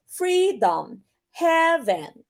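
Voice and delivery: FREEDOM and HEAVEN starting high, then dropping; first-syllable stress. Shown as a demonstration. Teacher showing trochaic examples, first syllables stressed in FREEDOM, HEAVEN. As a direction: hit "Free" and "Hea", relax the second syllables. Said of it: "una yung stressed".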